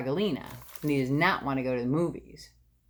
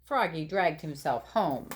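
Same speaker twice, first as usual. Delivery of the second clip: ordinary delivery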